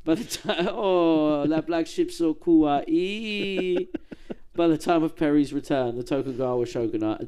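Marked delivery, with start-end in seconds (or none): 0.0-3.9 much higher, slow cooing over something cute; cooing kawaii. Pretend. Podcaster gushes over baby robot ships, slow, much higher and cooing.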